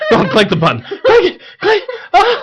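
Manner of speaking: hammed-up thrill as the button gets clicked; mock excitement. Host playing an auctioned item, squealing as he's bought.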